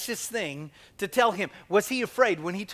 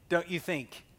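ordinary talk

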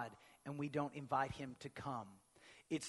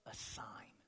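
whispered reveal; hushed; 6 semitones lower, 11 dB quieter than usual. Acted. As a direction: drop to a whisper on the sign. Reading Isaiah dramatically.

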